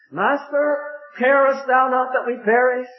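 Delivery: high, urgent disciples' plea; pleading cry. Acted. Disciples calling the Master in the storm, high plea.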